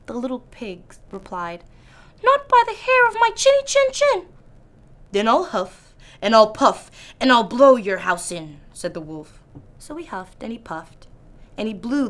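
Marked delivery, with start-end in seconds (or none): 2.2-4.3 high, loud, squeaky piglet bravado; trying to sound brave. Acted. Little pig defies the wolf at his door, high and brave. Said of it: "Trying to sound brave". 5.1-8.6 wolf threat. Acted.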